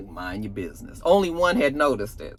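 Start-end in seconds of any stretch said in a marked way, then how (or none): 0.1-1.0 low dismissive. Retold or imitated. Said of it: "And Jannard was like".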